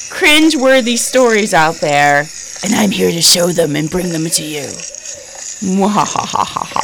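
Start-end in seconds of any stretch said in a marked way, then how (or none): 5.7-6.8 evil cackle, quiet and spooky; mad scientist. Acted. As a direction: cackle like a villain. Evil laugh after scary intro.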